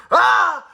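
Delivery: big high, loud sneeze build-up; mock sneeze. Sneezy lets out a huge sneeze, hammed-up. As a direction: let out an explosive pretend sneeze. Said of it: "with a sneezy look, like he's going".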